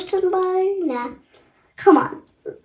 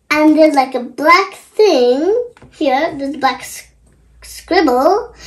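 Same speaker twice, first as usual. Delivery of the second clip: ordinary talk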